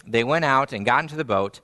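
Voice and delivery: usual speaking voice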